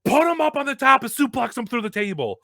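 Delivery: high-pitched retold yell of command; Kevin Graham yelling. Colleague ordering a table suplex, yelling. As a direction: imitate someone yelling orders. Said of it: "Kevin Graham's yelling".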